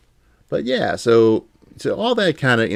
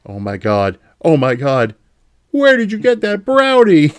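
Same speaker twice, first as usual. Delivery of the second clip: much higher, excited Homer; cartoon Homer, higher and louder; Homer Simpson voice; 7 semitones higher, 5 dB louder than usual. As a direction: gush like Homer over food. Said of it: "act out a scene from a classic Simpsons".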